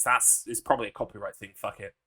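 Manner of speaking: ordinary delivery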